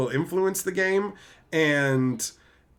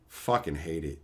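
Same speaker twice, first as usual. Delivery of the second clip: ordinary delivery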